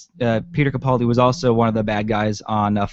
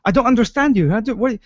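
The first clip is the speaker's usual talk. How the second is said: higher-pitched, flustered retelling of confusion; freaking out; 9 semitones higher than usual. Retold or imitated. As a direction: imitate someone freaking out, tripping over words. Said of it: "he's freaking out".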